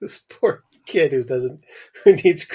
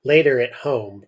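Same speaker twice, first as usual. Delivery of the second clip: ordinary delivery, nothing marked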